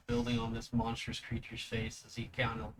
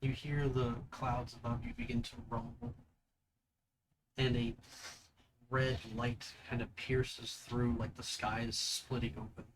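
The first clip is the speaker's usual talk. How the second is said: GM scene narration. Acted. GM describes the vision.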